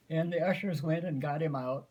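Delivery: ordinary delivery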